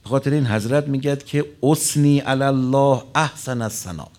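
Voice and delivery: impassioned sermon delivery; full-force preaching. Acted. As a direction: proclaim it to the congregation.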